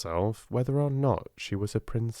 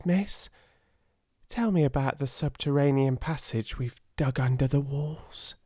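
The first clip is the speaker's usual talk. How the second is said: raised, lightly voiced young servant girl; a grateful little drudge pouring out her heart; Becky the maid; 7 semitones higher than usual. A narrator's reading. Scullery maid confiding in Sarah, raised and eager.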